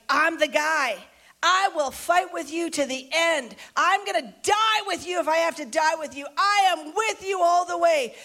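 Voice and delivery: high-pitched, fervent pledge of loyalty; emphatic vow. Acted. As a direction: declare it like a boastful devotee. Peter boasting loyalty to Jesus.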